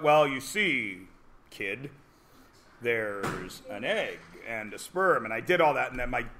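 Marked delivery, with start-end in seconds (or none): 0.0-5.2 put-on slow, low, careful explaining to a kid; measured and much softer, picking the words slowly; scientific explanation. Father explaining reproduction to his kid, scientific and careful. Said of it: "I was very scientific".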